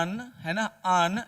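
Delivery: usual speaking voice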